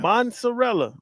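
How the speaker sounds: "Mozzarella" said "mont-sa-RELL-a"; an unwitting "MON-sa-rella"; N put in mozzarella. Real, not acted. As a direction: slip an N into "Mozzarella". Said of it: "It's not mozzarella".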